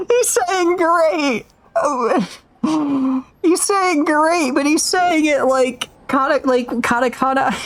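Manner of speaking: high, slow words through real laughter; laughing. Real, not acted. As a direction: squeeze the words out while still cracking up. Still laughing at own joke.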